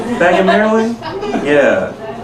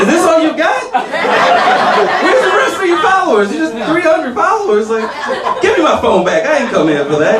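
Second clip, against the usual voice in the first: put-on much higher, scoffing girl voice; disgusted girl; 10 semitones higher than usual. The girl at the bar sees his Instagram, high scoffing voice. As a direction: sneer at his follower count. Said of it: "She's like".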